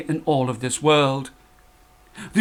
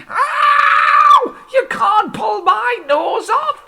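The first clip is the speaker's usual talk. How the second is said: much higher, loud yelp; comic pained scream; 4 dB louder, much slower than usual. Acted. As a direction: yelp as she yanks his nose. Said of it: "the old man screamed".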